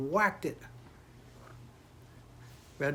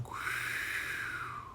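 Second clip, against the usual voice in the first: long airy whooshing sound; wind whoosh; 8 dB quieter than usual. A narrator's reading. Telling of wind in the night, long airy whoosh. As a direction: imitate wind blowing past. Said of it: "sound of the wind going".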